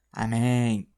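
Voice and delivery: "Amém" with a drawn-out stressed last syllable; stressed final syllable. Shown as a demonstration. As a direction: lean on the end of "Amém". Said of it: "Ali você é obrigado a falar amém". ("Amém" com a última sílaba tônica)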